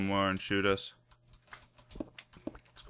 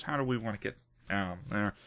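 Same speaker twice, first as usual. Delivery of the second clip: ordinary talk, nothing marked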